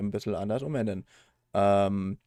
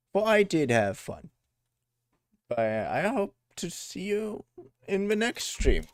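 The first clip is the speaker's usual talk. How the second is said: cheery outro; 11 semitones higher, 3 dB louder than usual. Real, not acted.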